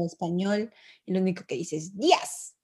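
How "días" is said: grumpy clipped greeting. Retold or imitated. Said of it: "según él es "buenos días".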